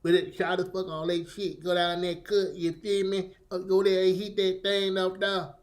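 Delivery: soft, slurred mock Florida Creole accent; barely intelligible Creole-like accent. Imitating Florida men he could barely understand, mumbled mock Creole accent. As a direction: mumble it in a mocked patois-type accent. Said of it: "I could barely understand what the hell they're talking".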